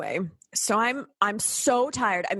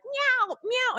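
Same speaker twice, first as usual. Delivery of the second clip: high mock cat meows; 14 semitones higher than usual. Imitating a cat that hates being held, high meows. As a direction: yowl like an unhappy held cat. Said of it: "they're like".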